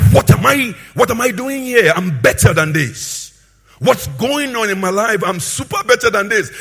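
preacher voicing an exasperated wake-up moment; prodigal son's realization. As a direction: lightly act a son coming to his senses. Acts prodigal son's sudden self-awareness.

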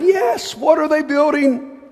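high-pitched mocking jeer; sneering mock. Preacher voicing Tobiah mocking the builders with a high sneer. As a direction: sneer it up high like a taunting enemy. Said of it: "Tobiah the Ammonite was beside him and said".

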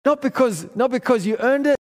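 full-force preaching. Acted. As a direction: lift the congregation with rising fervor. Preaching climax, rising fervor.

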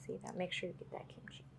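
hushed whisper aside. Real, not acted. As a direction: whisper it off to the side. Aside about kimchi on spoon.

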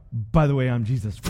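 a low, offhand drop of the big news; casual low-voiced reveal; deadpan Jesus reveal. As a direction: toss off the reveal lightly, voice dropped low. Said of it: "he's like".

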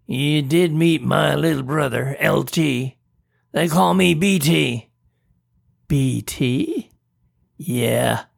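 playful creature voice; cheeky monster, friendly; goofy monster voice. A narrator's reading. B T speaks.

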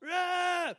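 high loud angry roar at a dog; short loud scolding yell. Retold or imitated. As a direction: bark out a sudden shout to scare it. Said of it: "you see somebody yell at this dog".

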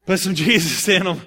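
laughing through the plea. Retold or imitated. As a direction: chuckle while begging for Jesus. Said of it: "they're all like".

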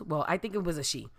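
ordinary delivery

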